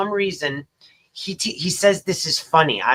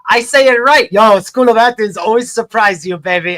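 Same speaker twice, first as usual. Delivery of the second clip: triumphant boast; 4 semitones higher, 7 dB louder than usual. Real, not acted. Triumphant boast after being right.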